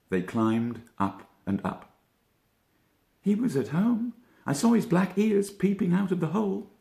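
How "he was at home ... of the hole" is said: a small rabbit begging for help, voice way up; frantic Benjamin. A narrator's reading. Benjamin begging Peter for news, high frantic voice.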